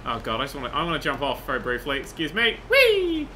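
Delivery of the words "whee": high-pitched gleeful whee; playful whee. Pretend. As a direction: squeal it as you leap off. Jumps off in the game.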